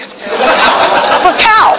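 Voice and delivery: laughing out the punchline, way up high; high breathy giggle on "A cow". Real, not acted. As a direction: crack up as you say it. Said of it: "laughing punchline".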